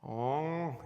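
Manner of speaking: imitated cow moo; drawn-out cow sound. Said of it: "sound like a cow than a dog".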